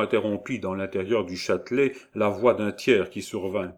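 usual speaking voice

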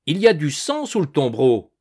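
surprised exclamation. A narrator's reading. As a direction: announce a startling find. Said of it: "poussa une exclamation de surprise".

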